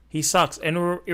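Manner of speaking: ordinary talk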